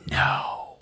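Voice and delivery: voiceless whisper of dawning dread; hushed, imitated disbelief; stunned whisper. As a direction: whisper it as it sinks in, acting out someone else. Agent's dawning realization, retold.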